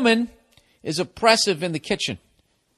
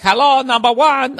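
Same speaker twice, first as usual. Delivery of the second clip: imitating Chinese restaurant worker; 7 semitones higher, 6 dB louder than usual. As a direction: answer the phone like the takeout place. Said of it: "They're like".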